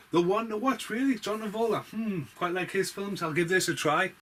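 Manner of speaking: a lightly voiced customer browsing the shelf; musing customer. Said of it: "you pick a film out and go".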